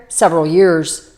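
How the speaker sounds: usual speaking voice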